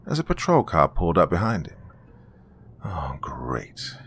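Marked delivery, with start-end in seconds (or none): 2.8-4.0 soft whispered groan, much lower; muttered. Acted. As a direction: mutter it slowly under your breath. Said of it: "he muttered to himself slowly".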